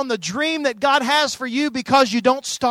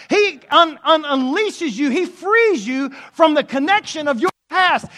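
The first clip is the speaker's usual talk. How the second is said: rising, louder preaching voice climbing to a peak; full-force preaching; 6 semitones higher, 5 dB louder than usual. Acted. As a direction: preach it with mounting fire, louder and higher. Preacher building to climax.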